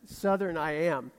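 ordinary delivery

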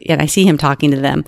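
ordinary talk

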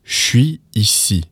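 "je suis ici" as "shwee ee-see", no liaison; slow demo of slang "shwee ee-see"; slang contraction. Shown as a demonstration. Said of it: "modify that to be".

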